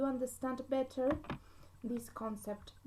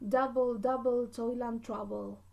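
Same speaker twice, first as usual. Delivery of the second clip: DOU-ble, DOU-ble, TOIL and TROU-ble, strong then weak; trochaic stress. Shown as a demonstration. Teacher quoting the witches' line as an example, trochaic stress. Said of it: "stressed syllable that is followed by an unstressed".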